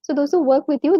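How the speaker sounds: ordinary delivery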